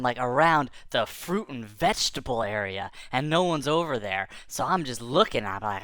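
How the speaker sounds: ordinary delivery, nothing marked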